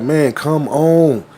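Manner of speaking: slow, stretched call; drawn-out kid call. Retold or imitated. Kid across the street calling slowly. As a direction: drag out the come on.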